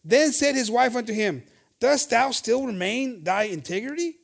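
loud, slow solemn reading; scripture reading. Acted. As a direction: proclaim the verse. Scripture read aloud.

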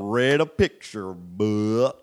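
slow, loud, thick Southern accent; exaggerated Southern, words stretched out; intense Southern accent. Shown as a demonstration. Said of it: "do an intense Southern accent".

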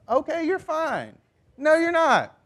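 very high-pitched mock agreement turning to contradiction; disbelieving onlooker. Onlooker who doesn't buy that they're fine, mock disbelief. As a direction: play along, then flatly contradict. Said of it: "everyone around you is like".

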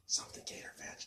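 whispering. Real, not acted. Hinting to be invited as guests.

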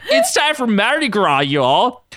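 high, slow mock Southern drawl; exaggerated southern twang; horrible southern accent. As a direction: ham up a southern accent for the camera. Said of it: "with a horrible southern accent".

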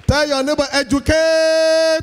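loud, high, slow command; preaching shout. Real, not acted. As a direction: urge the crowd with force. Preacher urging congregation.

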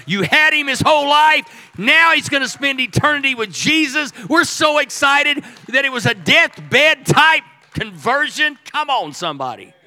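roaring sermon climax; full-force preaching. Acted. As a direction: lift the room, shouting the good news.

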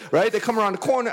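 normal talking voice

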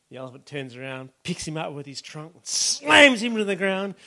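ordinary talk, nothing marked

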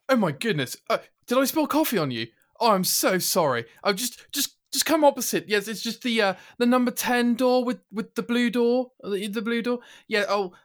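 high, flustered, apologetic posh English voice; posh British Hugh Grant. Acted. Host doing posh Hugh Grant for his co-host, posh and flustered. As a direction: play it pretentious and very British, fumbling apologies. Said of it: "I'm gonna try my best ... A bit of pretentiousness and very Britishness".

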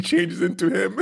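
cracking up mid-sentence; laughing. Real, not acted. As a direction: laugh through the name. Cracking up at the name.

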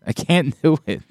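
normal talking voice

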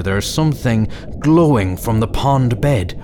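ordinary delivery

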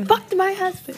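high dramatic quote; movie quote, high-pitched. Retold or imitated. Quoting movie line dramatically.

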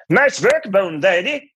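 put-on very high, loud cod Scandinavian accent. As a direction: punch it as a lilting mock-Scandinavian bandleader. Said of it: "Please let me do [name]".

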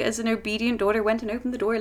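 ordinary delivery

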